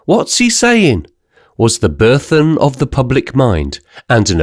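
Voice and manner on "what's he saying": high mock scream of a heckler; a crowd wit screaming speech; much higher jeering cry. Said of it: "Some wit was audible screaming".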